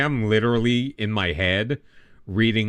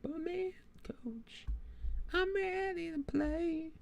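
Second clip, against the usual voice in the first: soft, very high, thin little voice; slow, hushed high-pitched plea; player pleading to coach; 14 dB quieter, slower than usual. Acted. Player telling coach he's ready, tiny high voice.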